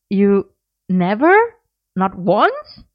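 can't believe what he hears; disbelief. Acted. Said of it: "Merlin goggled at him".

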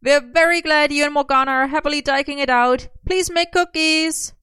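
high, loud holler; loud high-pitched call to someone across the room; shouted. A narrator's reading. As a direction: shout it pitched high, stretching the final plea. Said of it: "Merlin shouted, still dangling from Arthur's shoulder".

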